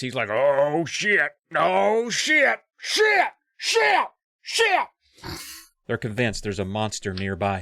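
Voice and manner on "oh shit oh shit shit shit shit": escalating to high, repeated yelps; mock panic. Arthur panics over giant footprints in the snow, mock panic. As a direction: start moderate and climb into cartoon panic. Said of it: "and Arthur panics".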